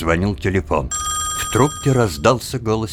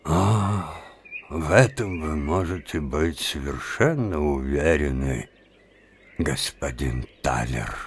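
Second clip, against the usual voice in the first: sly menace; 2 semitones lower, 4 dB quieter than usual. Acted. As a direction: purr it with hidden menace. Tröch's sly reply.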